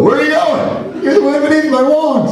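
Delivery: a high, giggly parody of a romantic hero; mocking movie lover. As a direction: swoon it out in a high mocking voice through laughter. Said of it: "I made fun of her".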